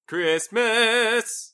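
high, drawn-out gleeful cheer; playful cheer. Pretend. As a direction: draw the word out with festive glee. Gleefully echoes the word Christmas.

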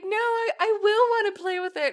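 high-pitched imitation of a protesting kid; insistent child. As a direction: protest like a small son. Voices son's insistent protest.

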